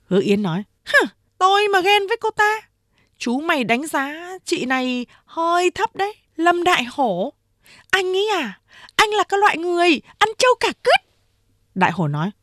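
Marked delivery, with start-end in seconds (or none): 0.9-11.0 high-pitched scornful woman; scornful retort. A narrator's reading. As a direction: sneer back at him in a high voice. Hứa Yến scornfully retorts.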